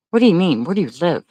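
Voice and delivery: ordinary delivery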